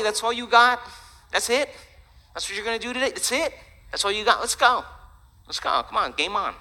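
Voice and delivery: high-pitched, goading questions and urgings; taunting challenge. Acted. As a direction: egg on an opponent, pitched well up.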